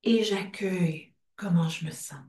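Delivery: soft unvoiced whisper. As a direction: let it fade into a whisper. Closing affirmation of round.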